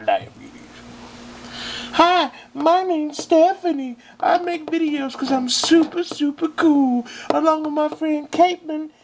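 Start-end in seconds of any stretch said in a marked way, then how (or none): 1.9-8.9 put-on much higher, bubbly valley girl voice; girly voice. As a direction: play a ditzy girl, pitched way up. Man plays girly Stephanie.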